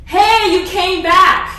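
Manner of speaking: slow, loud, high "you came back"; delighted call. Retold or imitated. Oscar calls to woman at bar, slow loud delight. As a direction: call out to someone you hoped to see.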